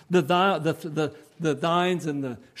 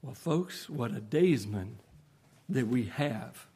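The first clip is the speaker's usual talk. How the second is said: much softer, almost breathed; hushed; 8 semitones lower, 9 dB quieter than usual. Real, not acted. Preacher ending softly to the congregation, hushed. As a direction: drop to a hush for the close. Soft closing about the daysman.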